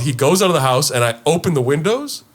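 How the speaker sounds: normal talking voice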